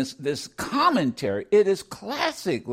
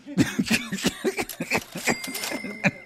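high breathy burst of laughter; laughing; 10 semitones higher than usual. Real, not acted. Host cracks up at his own Bert and Ernie joke, laughing.

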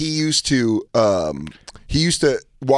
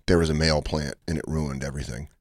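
mock deadpan lament; 7 semitones lower, 5 dB quieter than usual. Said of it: "and he was like".

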